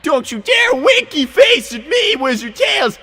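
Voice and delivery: high, loud hammed-up yelling; mock furious rant. Yelling back at Wizard Tails for his winky face, hammed-up fury. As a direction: scold him like a cartoon villain. Acted rant at game character.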